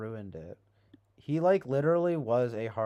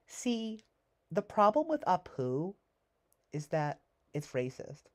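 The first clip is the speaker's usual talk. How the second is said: high, whiny preachy scold; mocking high voice; 8 semitones higher than usual. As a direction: mimic a self-righteous critic in a high voice. Mocking the film's message.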